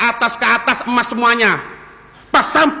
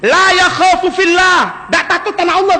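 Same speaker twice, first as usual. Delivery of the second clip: fiery preaching; 7 semitones higher, 4 dB louder than usual. Real, not acted. Preaching at full force.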